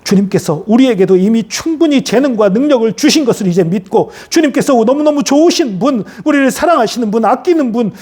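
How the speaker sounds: high, urgent chanting petition; fervent prayer. Real, not acted. Pastor asking the Lord for courage and strength, fervent prayer. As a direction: plead earnestly in long surging phrases.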